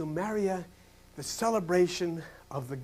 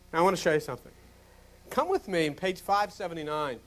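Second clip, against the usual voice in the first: normal talking voice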